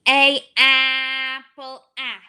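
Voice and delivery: "A, apple" drawn out very slowly; teacher thinks aloud; slow vowel check. Shown as a demonstration. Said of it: "Let's see".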